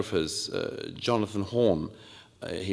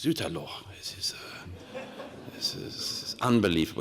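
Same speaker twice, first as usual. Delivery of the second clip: quiet, breathy shock trailing off; stunned dismay; 3 semitones lower, 5 dB quieter than usual. Retold or imitated. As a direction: let the shock drain the voice, softer. Shocked admiral reacts.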